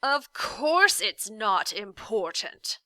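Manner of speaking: slow, loud and snarling; an irritated man snarls at the boy; angry snarl. A narrator's reading. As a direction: bite out each word in anger. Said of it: "he snarled angrily".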